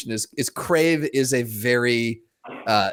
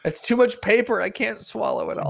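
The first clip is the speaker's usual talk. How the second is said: 1 complaining toilet; 8 semitones higher than usual. Pretend. Cohost voicing the toilet.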